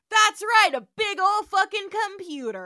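loud, high big reveal; dramatic reveal. Real, not acted. Telling a friend the Star Trek twist, loud and high. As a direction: unveil it like a game show twist. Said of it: "dramatic reveal".

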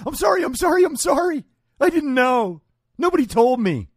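high, loud, whiny mock pleading; shrill sarcastic impression of a frantic apologizer; mock panicked apology. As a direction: squeal it like a cornered coward begging. Mocking opponents' panicked apology.

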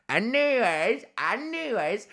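high, drawn-out playful repeat; silly singsong. Pretend. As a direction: toy with the word in a silly high voice. Silly repeat of own transition.

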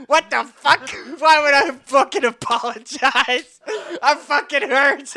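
high, loud mock outrage; indignant outburst. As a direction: blurt out the retold thought in hammed-up disbelief. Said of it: "I just remember thinking".

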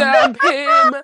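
high, loud shout of the advice. Retold or imitated. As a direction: yell it like a crowd. Chanting the followers' advice.

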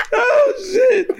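shrieking with laughter; laughing hard. Real, not acted. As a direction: howl with laughter, high. Crew cracking up.

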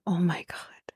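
breathless unvoiced whisper; a stunned hush under the breath; whispered oh my God. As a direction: whisper it in disbelief. Reacting to the duet lyric.

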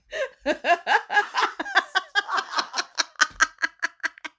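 high, drawn-out laughing fit; long laugh. Real, not acted. After teasing about talking over each other, long laugh.

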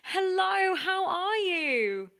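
high, drawn-out hello; gushing host greeting. Acted. Host opening door to first guest, gushing. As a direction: stretch the welcome out slowly, falling at the end.

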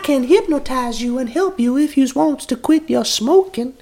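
high, drawling Southern belle; Deep South accent. Acted. Hypnotist offers date a smoking cure, high Deep South accent. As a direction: pitch it as a Deep South lady. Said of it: "I'll stop doing the accent".

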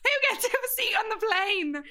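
high-pitched excited announcement; mock hype. Friend jokes about winning a plane seat, mock hype. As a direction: tease it out like a prize reveal. Hyped mock game-show boast.

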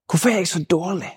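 measured questions with long pauses, a hint of gloom. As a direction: let each doubt hang in the silence. Leads an exercise of negative questions.

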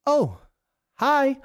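high, surprised little greeting; a startled woman caught off guard. Acted. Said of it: "the woman stops in her tracks and says".

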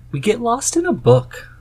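spring it as a playful surprise; mock dramatic reveal. Husband teasing listeners about the hobby, mock reveal. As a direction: jump high on the punchline. Teasing build-up after a pause.

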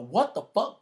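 high, loud startled yell; waking up strapped down, yelling in shock; attempted yell. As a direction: shout it out in panic. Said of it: "So I try to yell out".

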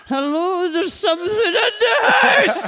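put-on high, loud, slow old-woman cry of alarm; a frantic old lady calling for help; panicked old woman. Prank call to the pest line, high panicked old woman. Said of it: "pretending to be an old woman".

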